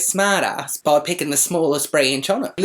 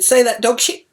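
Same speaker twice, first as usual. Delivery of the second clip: higher, louder barked demand; louder stern repeat; 9 semitones higher, 5 dB louder than usual. Retold or imitated. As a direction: raise the voice on the second asking. Mother repeats order louder.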